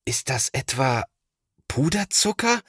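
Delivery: rising in disbelief, pitch leaping up on the last word; a big brother who can't believe his eyes; incredulous question. A narrator's reading. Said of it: "fragt Thomas ungläubig". (ungläubig fragend, Stimme steigt hoch)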